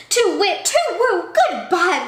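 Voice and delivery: high, loud owl hoots; hooting owl. Acted. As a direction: hoot like a startled owl.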